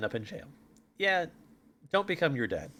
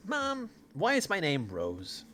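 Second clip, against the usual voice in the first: lightly voiced little daughter, higher and slow; higher, drawn-out question from a kid; child asking mother; 6 semitones higher, slower than usual. Daughter asking her mother about her name, lightly childlike. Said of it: "first daughter goes up to the mother".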